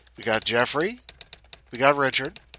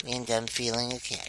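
ordinary delivery, nothing marked

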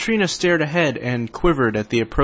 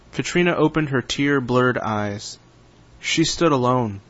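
normal talking voice

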